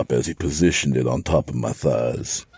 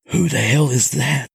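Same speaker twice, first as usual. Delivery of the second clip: urgent, higher stage whisper; 10 semitones higher than usual. A narrator's reading. Michael sees the bound woman, whispering. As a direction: hiss the question in shock. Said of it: "Michael whispered".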